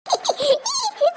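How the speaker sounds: high-pitched bashful giggle; embarrassed high titter; shy giggle. Acted. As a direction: giggle shyly after singing. Bashful after singing to sweetheart.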